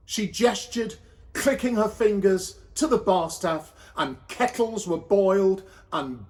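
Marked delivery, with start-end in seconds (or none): none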